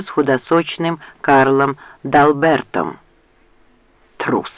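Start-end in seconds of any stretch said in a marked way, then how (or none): 4.2-4.5 one short, understated scornful word; contemptuous snort. A narrator's reading. Rand scorns the fleeing duke, mildly contemptuous. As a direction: toss it out with mild contempt. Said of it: "презрительно фыркнул Ранд".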